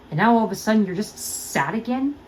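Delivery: usual speaking voice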